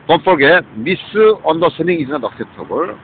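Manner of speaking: drawn-out quoted rule, very slow at the end; slow quoted warning. Retold or imitated. Quoting a rule to listeners, slow and deliberate. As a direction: read the rule out slowly and firmly. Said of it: "misunderstanding은 cannot acceptable이요".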